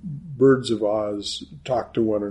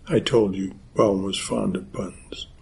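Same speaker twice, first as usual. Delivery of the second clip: usual speaking voice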